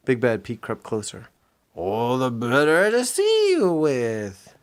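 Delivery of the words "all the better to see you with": slow, lightly put-on granny; funny granny voice. Disguised Pete creeping closer, slow fake granny. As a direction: drag it out sweetly.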